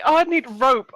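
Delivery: high, loud mock whine; mocking imitation. Helper mocks stranger's need for rope, mocking imitation. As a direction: throw the plea back mockingly.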